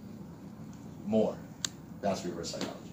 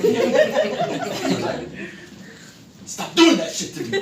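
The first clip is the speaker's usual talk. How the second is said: loud, high, laughing yell; friend's outburst retold through laughter; laughing retell; 9 dB louder, much slower than usual. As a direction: laugh through his shouted complaint.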